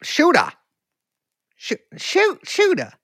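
high Carolina "Shooter" with the final r dropped; Carolina accent. Shown as a demonstration. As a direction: try "Shooter" as "shoo-tah" in a Carolina accent. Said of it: "how do people from here talk".

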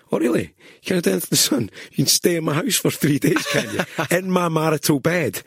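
high, loud mock-indignant sarcasm; sarcastic jibe. Mocking Paolo for refusing a favour, loud and sarcastic. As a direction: needle him with exaggerated disbelief.

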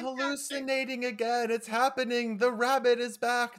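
high, loud frantic babbling; panicked McCoy. Retold or imitated. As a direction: spiral into panic about the rabbit.

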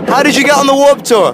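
loud, high shouting over roller coaster noise; shouting over the ride. Real, not acted. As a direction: yell every word over the wind. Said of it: "You can hear me screaming for my life".

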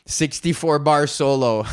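normal talking voice

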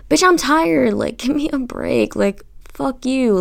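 playful sassy comeback to a lifting buddy. Retold or imitated. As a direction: snap back at him, half joking. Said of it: "being, like, sassy and annoying to him".

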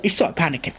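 ordinary delivery

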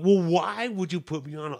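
demanding, fed up with the runaround; angry complaint to the clerk; mad. Retold or imitated. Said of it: "I got really mad".

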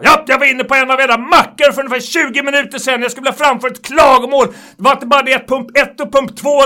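loud, high indignant customer voice; angry complaining customer. Retold or imitated. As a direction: rant it like an outraged caller.

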